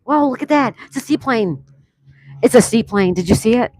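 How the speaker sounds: loud, high, thrilled exclaiming; real excited outburst; amazed shout. Real, not acted. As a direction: shout with wonder at the plane. Spots a seaplane flying past.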